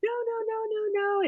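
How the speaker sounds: high, soft wailed protest, retold; mock-tearful high pleading, no real crying; imitated sobbing. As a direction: mimic a tearful sister on one high note. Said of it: "she's just sobbing".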